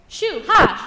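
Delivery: high, loud shriek to scare off birds; loud high-pitched scarecrow yell; screeched shooing. A narrator's reading. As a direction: screech it sharply to drive them away. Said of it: "she screeched".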